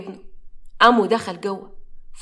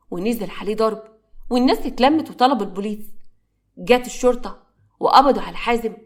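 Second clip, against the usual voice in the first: ordinary delivery, nothing marked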